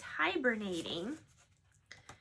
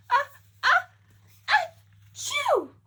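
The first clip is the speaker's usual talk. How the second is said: big sneeze; 10 dB louder than usual. Acted. Bear sneezing from pepper, loud and high. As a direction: build the ah's up, then burst. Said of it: "You go".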